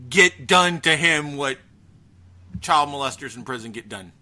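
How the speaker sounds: ordinary talk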